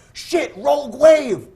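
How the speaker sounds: mock captain spotting danger; captain's alarm. Captain warning the guests, slow alarmed outburst. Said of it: "the captain's like".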